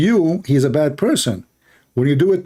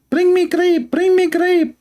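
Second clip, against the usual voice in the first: high, nasal-free mock husband squeal, much higher; demanding husband. Husband ordering his wife for grapes, high mock voice. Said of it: "He told the wife".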